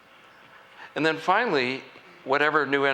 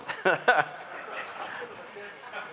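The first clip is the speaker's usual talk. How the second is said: laugh; 9 semitones higher, 4 dB quieter than usual. Real, not acted. Laughs after Republican joke.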